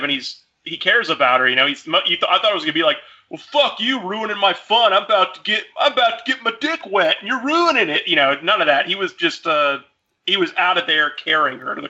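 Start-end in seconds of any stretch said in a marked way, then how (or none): 3.3-8.1 higher, petulant mock complaint; whiny selfish brother. Imagined brother griping at his sister, mock whiny higher voice. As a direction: gripe like a spoiled teen robbed of his fun.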